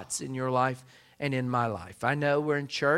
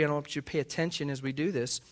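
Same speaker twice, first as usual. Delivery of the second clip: normal talking voice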